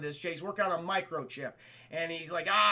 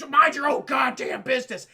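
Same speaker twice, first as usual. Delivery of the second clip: loud, raised mock anger; angry retort; 5 semitones higher, 9 dB louder than usual. Attacker brushing off his partner, loud acted anger. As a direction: tell him off forcefully, acted out.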